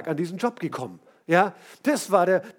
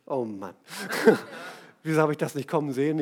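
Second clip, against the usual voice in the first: resigniert gedacht; 5 semitones lower, faster than usual. Retold or imitated. Frau denkt resigniert beim Feld.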